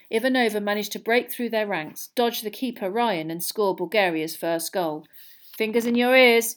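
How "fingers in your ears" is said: a lightly raised warning call; bellowed. A narrator's reading. Mr Weasley warns the family about the Vila, mildly raised. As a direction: warn everyone firmly. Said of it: "bellowed Mister Weasley".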